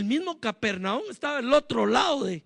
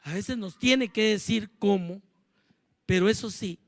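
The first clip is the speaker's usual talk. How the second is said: leading prayer; 3 semitones lower than usual. Real, not acted. Pastor guiding the congregation in morning prayer, hushed. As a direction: gently lead them into prayer.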